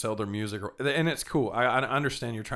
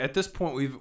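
ordinary talk

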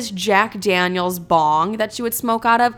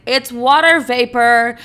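naive self; 4 semitones higher, 7 dB louder, slower than usual. Retold or imitated. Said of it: "I don't know why I was like".

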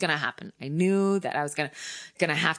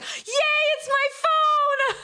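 squealing relief; slower than usual. Real, not acted. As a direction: celebrate the phone like a prize. Phone finally restored.

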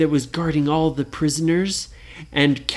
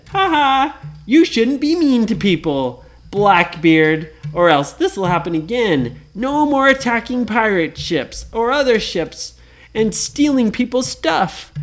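mock scolding; 6 semitones higher, 4 dB louder than usual. Kids lecture Blackbeard.

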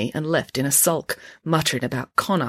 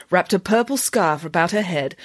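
plain reading voice

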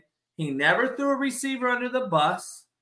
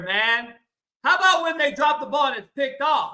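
normal talking voice

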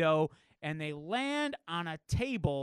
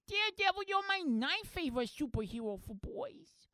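put-on high, small child's voice; little girl voice; 11 semitones higher than usual. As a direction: gush like an eager kid. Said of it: "The little girl goes".

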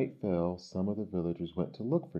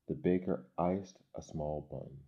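ordinary delivery, nothing marked

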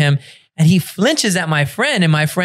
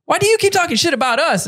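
high, fast mock accusation; high quick retort from the family; family accusing; 11 semitones higher, faster than usual. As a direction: fire the question back at him. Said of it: "they're like".

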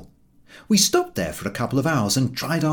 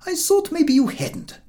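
put-on higher, gentle old man; kindly old gentleman; 3 dB louder than usual. The old gentleman reassures the walkers, high and gentle. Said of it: "said the old gentleman".

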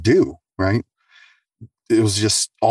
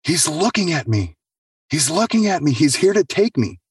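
much higher, fearful repeating; terrified warning; 8 semitones higher than usual. Retold or imitated. Patient pointing at the dark bathroom, high and frightened. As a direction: warn them in terror. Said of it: "And she's like".

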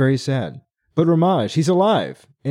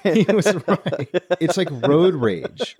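laughing; 4 semitones higher, slower than usual. Real, not acted. Laughing at the unhinged pilot.

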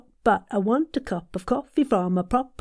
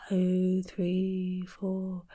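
breathing from the tummy; 2 semitones lower, 6 dB quieter, much slower than usual. Shown as a demonstration. Coach counts tummy breaths, slow and fading. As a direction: ease each number out on a long breath. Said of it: "So breathe from your tummy".

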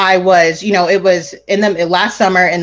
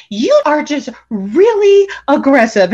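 higher, drawn-out mock accusation; retold accusation; 6 semitones higher, slower than usual. Crying friend accuses the speaker, mock wounded accusation. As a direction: imitate her accusing, slow and raised.

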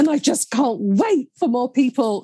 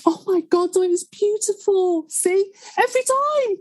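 much higher, tearful, voice cracking; crying after the poem; 9 semitones higher than usual. Real, not acted. Host after reading the poem, tearfully overwhelmed. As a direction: overcome with real tears, half laughing. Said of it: "a wobbly voice and a couple of tears".

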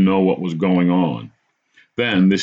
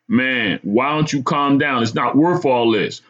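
raised-pitch appeal to reason; trying to calm; 6 semitones higher than usual. A narrator's reading. Narrator urges the madman to stop, trying to calm him. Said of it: "I tried to calm him".

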